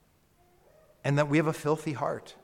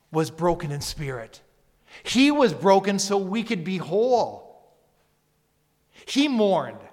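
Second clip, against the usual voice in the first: loud, impassioned preaching; full-force preacher's climax; fervent preaching; 3 semitones higher, 6 dB louder than usual. Real, not acted.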